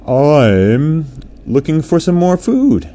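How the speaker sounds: a hungry orca piping up. A narrator's reading. Orca character speaks.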